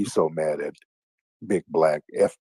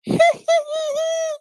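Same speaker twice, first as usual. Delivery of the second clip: mock high laugh; 3 dB louder than usual. Host mocks the caller's laugh.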